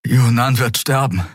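winded and urgent; panting. A narrator's reading. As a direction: gasp it out, short of breath. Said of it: "keuchte Kai".

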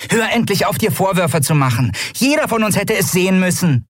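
high, small character voice; high-pitched, no-nonsense reproach; reproachful scolding. A narrator's reading. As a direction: scold a friend in a high, insistent voice. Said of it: "die Arme tadelnd verschränkt".

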